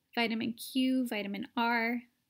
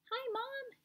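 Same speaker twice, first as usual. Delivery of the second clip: very high, much softer squeak; tiny little voice; 13 semitones higher, 9 dB quieter than usual. A narrator's reading. Shrunken boy greeting his mother, tiny squeak. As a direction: squeak like a mouse. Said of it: "In a tiny little voice".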